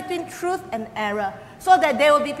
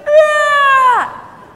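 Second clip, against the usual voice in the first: high loud mock wail; mimicked baby wail; 12 semitones higher, 9 dB louder than usual. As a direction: imitate a crying toddler.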